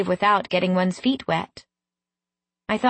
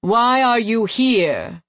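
slow, weighty demand; imperious fey man; 3 semitones higher, 4 dB louder, slower than usual. A narrator's reading. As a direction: question the intruder slowly and firmly. Golden fey king interrogates her.

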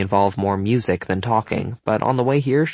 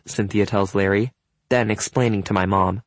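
ordinary delivery

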